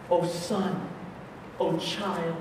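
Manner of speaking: soft, low, halting reassurance; tender divine address. Acted. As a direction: let it sink almost to a hush, pausing between phrases. Preacher voices Jesus tenderly to a child.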